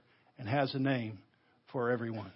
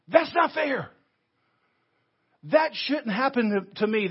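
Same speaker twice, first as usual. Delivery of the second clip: hurt protest; 6 semitones higher, 4 dB louder than usual. Acted. Voicing a hurt believer's protest.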